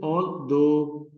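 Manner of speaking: ALTHOUGH drawn out slowly on THOUGH; al-THOUGH, second syllable held long; stress on second syllable. Shown as a demonstration. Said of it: "ये इसकी sound दो की longer है".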